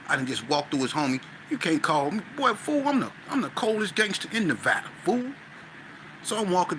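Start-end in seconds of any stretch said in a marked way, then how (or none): 1.5-5.3 cocky swagger. Retold or imitated. Boasting as coldest gangster.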